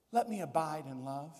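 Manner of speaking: a lightly voiced, earnest plea; humble prayer. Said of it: "I wanna say".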